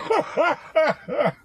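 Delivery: a high, real burst of laughter. Real, not acted. Vlogger laughing at the short border wait.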